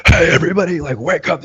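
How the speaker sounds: high-pitched in-your-face talk radio shout-out; mock talk-radio wake-up call; AM radio host. Shown as a demonstration. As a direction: hail listeners like a conservative radio ranter. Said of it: "it's like A M radio".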